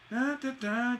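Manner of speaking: high sung-out DA, DA, DA; rhythmic filler syllables. Shown as a demonstration. Teacher fills in a line's metre, rhythmic da-das. Filling in the metre before phrase.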